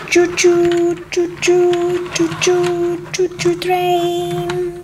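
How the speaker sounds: drawn-out choo-choo on a held note; train whistle. Pretend. Conductor driving the passengers along, whistle imitation. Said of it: "the conductor of this choo-choo train".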